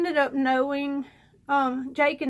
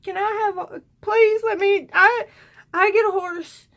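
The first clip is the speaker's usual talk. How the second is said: put-on drunken begging; 6 semitones higher, 4 dB louder than usual. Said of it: "I'm like".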